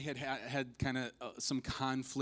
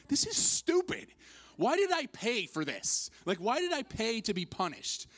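high, loud comic indignation; mock outrage at being pelted; exasperated outburst; 10 semitones higher, 5 dB louder than usual. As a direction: protest it loudly, pitched way up. Retold outburst during the paintball barrage.

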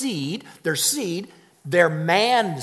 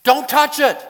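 loud; 9 semitones higher, 7 dB louder than usual. Acted. Preacher stresses the command.

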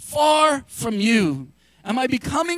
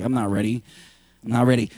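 much lower, whiny mock grumble; mock reluctant listener; 12 semitones lower, faster than usual. Pastor mimicking a congregation member who isn't ready, deep and mocking.

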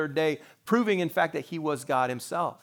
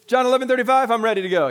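high, loud burst of eagerness; eager child's shout; 9 semitones higher, 10 dB louder, faster than usual. Acted. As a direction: blurt it out like a kid keen to answer. Eager child at verse memory time.

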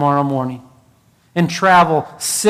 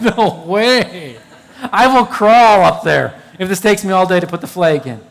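laughing nervously through the words; a giggly, half-scared no way; laughing refusal. Retold or imitated. Retelling his fear on the grandstand, laughing through it.

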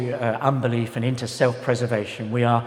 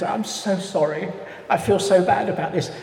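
lightly voiced apologetic lady, higher pitch; upper-middle-class London lady; 6 semitones higher than usual. Lady wringing her hands on the course, mock-apologetic. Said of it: "She was upper middle class".

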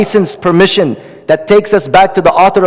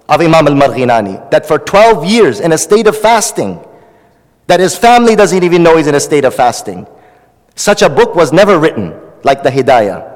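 normal talking voice

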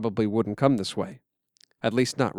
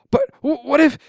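frightened stammer; 13 semitones higher, 5 dB louder than usual. Acted. Frightened boy stammers while fleeing.

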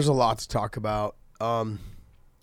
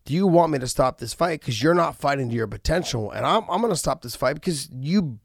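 loud, raised-pitch ultimatum; coach's fire-up; 5 semitones higher, 9 dB louder than usual. Retold or imitated. Coach threatening to stop the fight, loud fire-up. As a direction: push the fighter with a forceful warning. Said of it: "your coach telling you to light a fire".

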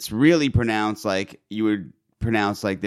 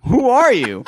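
incredulous mockery; 7 semitones higher, 4 dB louder than usual. Mocking brother's fancy pronunciation.

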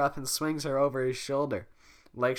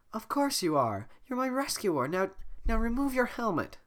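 high eager princess voice; 11 semitones higher than usual. Acted. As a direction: speak high and expectant. Voicing Fiona.

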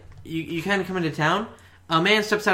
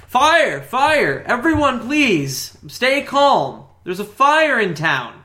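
higher, louder yelled town-crier alarm; 5 semitones higher, 4 dB louder, much slower than usual. Acted. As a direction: call out the fire warning to the whole town. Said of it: "he's yelling".

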